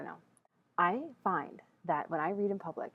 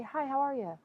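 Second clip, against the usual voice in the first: breezy, higher, throwaway greeting; perfunctory greeting; 8 semitones higher than usual. Shown as a demonstration. Casual passerby greeting said out of habit, breezily. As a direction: toss it off without caring. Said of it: "But if I just say".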